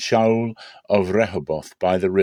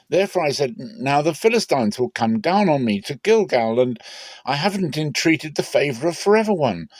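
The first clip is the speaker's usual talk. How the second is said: higher, louder urgent excuse; Saul pleading his case; 6 semitones higher, 3 dB louder than usual. Acted. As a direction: argue your case to Samuel with the voice pitched up high. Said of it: "Saul said".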